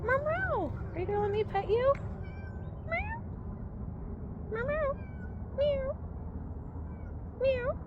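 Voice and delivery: high coaxing meow at a stray cat. Real, not acted. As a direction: coax the kitty with tiny high meows.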